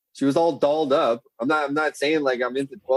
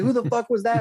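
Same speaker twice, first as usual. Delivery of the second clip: angry mom; 11 semitones higher than usual. Retold or imitated. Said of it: "the mom was like".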